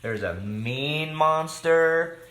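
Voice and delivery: ordinary delivery, nothing marked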